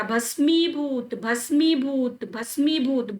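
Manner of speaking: भस्मीभूत slowly, long mee and long bhoo; drilled correct pronunciation. Shown as a demonstration. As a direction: chant भस्मीभूत for memorising, vowels stretched. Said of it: "बार बार याद करिए". (भस्मीभूत बार-बार, लंबी मात्राओं के साथ)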